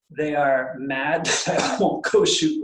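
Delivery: stifling laughter mid-sentence; trying not to laugh while speaking. Real, not acted. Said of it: "I'm trying not to laugh right now".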